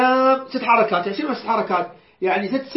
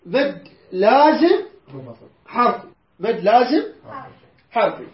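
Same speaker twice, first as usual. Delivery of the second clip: slow and deliberate; slower than usual. Shown as a demonstration. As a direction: spell out مد لازم syllable by syllable, very slowly. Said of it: "هذا يسمى".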